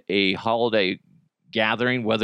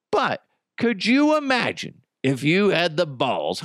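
ordinary talk

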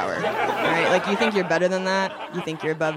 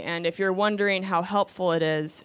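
usual speaking voice

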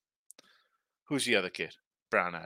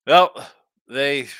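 ordinary talk

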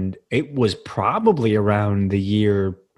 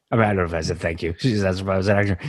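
ordinary talk

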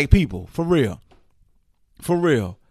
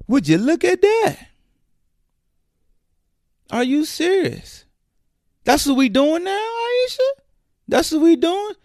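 much higher, exasperated disbelief; incredulous mockery. Real, not acted. Host calling out Aisha, high incredulous mockery. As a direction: squeak it out in disbelief. Mocking the wife's behaviour.

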